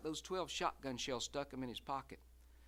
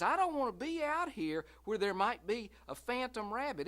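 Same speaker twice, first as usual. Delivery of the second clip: higher, louder scared voice; frightened plea; 6 semitones higher, 5 dB louder than usual. Acted. Wife afraid of the phantom rabbit, voice up high. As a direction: Lucille begging not to stay out.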